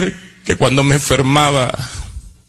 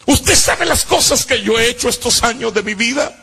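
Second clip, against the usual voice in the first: anguished reproach; 6 semitones higher than usual. Retold or imitated. Confronts the mother who abandoned him.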